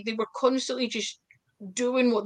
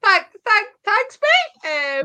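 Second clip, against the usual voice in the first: much higher, louder flustered stammered thanks; stammering mock thanks; 12 semitones higher, 6 dB louder, slower than usual. Replying to the coach's backhanded praise, mock flustered stammer. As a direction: stumble over thanks, mock stunned.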